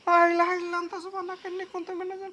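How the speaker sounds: hushed recited prayer, fading away; frightened prayer. Acted. Man afraid of a ghost, praying quietly and trailing off. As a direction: pray under your breath for protection.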